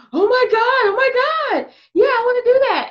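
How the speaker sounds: high, loud mock-excited man; excited client. Client thrilled by her instructions, acted out high and excited.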